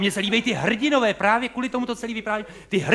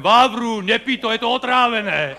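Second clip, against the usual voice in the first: calling out in a Moravian dialect, higher and louder; 4 semitones higher, 4 dB louder than usual. Shown as a demonstration. Audience member warning the actor Vávra, in Moravian dialect. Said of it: "tak dialektem".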